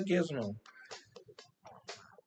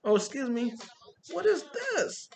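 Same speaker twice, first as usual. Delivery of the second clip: high, startled blurt that climbs even higher on the question; imitating a stunned host; 6 semitones higher than usual. Host A J Johnson sees them coming and cusses on the mic, imitated shock. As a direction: play the host caught off guard on the mic. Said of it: "He's like".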